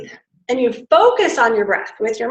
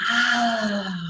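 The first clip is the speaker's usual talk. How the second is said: quiet breathy ah; soft sigh; 7 dB quieter than usual. Shown as a demonstration. Coach joining the class exhale. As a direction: breathe it out gently. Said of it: "She's breathing".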